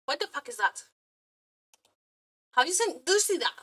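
normal talking voice

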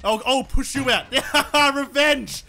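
ordinary delivery

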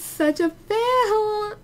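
high, drawn-out mock-crying wail; whiny lament. Real, not acted. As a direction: wail it, stretching the last word. Lamenting that nobody came.

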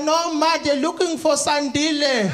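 put-on high-pitched child correcting a parent; small child. Small child corrects mother at the door, high voice. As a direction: pipe up high like a little one. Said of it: "The small child".

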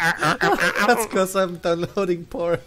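loud, high and slow through laughter; laughing retort. Real, not acted. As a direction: blurt the joke, cracking up. Joke retort, bursting into laughter.

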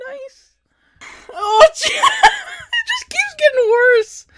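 high, loud, slow laughing words. Real, not acted. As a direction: laugh through it. Laughing at silly scene.

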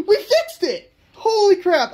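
high, loud and laughing with joy; excited. Real, not acted. Telling viewers the board is fixed, thrilled outburst. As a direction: shout the win, bursting with delight. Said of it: "I'm so excited".

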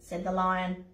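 ordinary delivery

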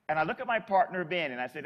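ordinary delivery

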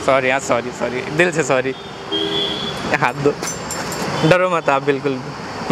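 low, gentle reassurance; calm them down with a sincere sorry; soothing apology. Real, not acted. Prankster comforting the scared fan, soothing and low.

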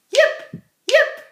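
very high, loud yips; sharp squeaky yips; fox yips. A narrator's reading. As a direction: yap like a fox. Imitates the fox.

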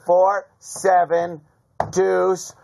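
slow, drawn-out card call; slow tense count. Retold or imitated. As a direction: count each card slowly. Counting cards dramatically.

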